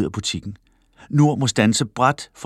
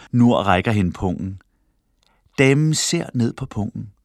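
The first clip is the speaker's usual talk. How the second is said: ordinary delivery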